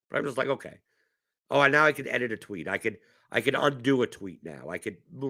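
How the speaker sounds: ordinary delivery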